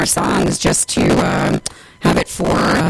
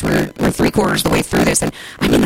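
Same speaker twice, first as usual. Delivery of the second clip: normal talking voice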